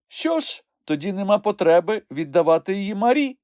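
high-pitched relieved conclusion. A narrator's reading. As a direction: wrap it up with relief. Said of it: "полегшено зітхнув Хропусь".

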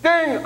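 high sung ding of the seatbelt chime; ping like the plane's bell; chime sound. Retold or imitated. Pastor imitating the plane chime, high ding.

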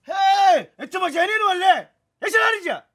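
high, loud indignant outburst; outraged protest. Acted. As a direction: call them out in disbelief. Outraged at the others' scheme.

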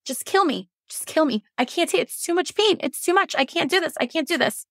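high, desperate pleading without volume; imitated anguished cry, pitched high; retold desperate screaming. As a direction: re-enact begging through pain, pitch climbing. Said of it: "I was screaming at the ceiling".

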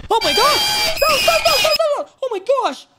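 high panicked shrieks of stop; terrified screaming. Real, not acted. Jump scare hits in horror game.

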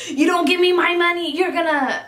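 high-pitched mock threat; agitated but not truly yelled; retold yelling match. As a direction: imitate an irate man demanding payment. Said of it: "they're getting in a yelling match".

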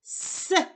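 С hissed alone as a bare s sound; isolated sound. Shown as a demonstration. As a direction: sound out С on its own, no vowel. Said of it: "Який ми чуємо перший звук?". (звук «с» окремо)

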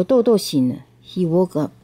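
usual speaking voice

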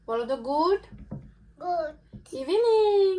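mother playfully coaxing her little son, high and sing-song. Real, not acted. Mother coaxing her small child.

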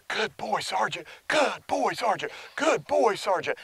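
high, affectionate praise for the dog; loving reward voice. Shown as a demonstration. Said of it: "Daddy says".

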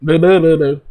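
loud playful blubbering noise; nonsense babble. Pretend. Teacher clowns for the class, loud mock babbling. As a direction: burst into loud "bl-bl-bl" gibberish.

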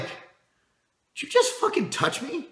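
high, slow, incredulous challenge; outraged confrontation. Retold or imitated. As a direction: drag it out, stunned and furious at the touch. Confronted after being hit in the chest.

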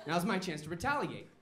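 ordinary talk

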